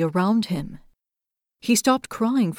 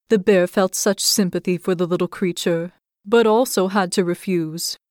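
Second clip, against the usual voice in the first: usual speaking voice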